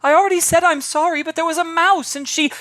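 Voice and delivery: put-on high, loud protesting kid voice; whiny child. Young girl protesting loudly to the grown-ups. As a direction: plead your case as a child cut off.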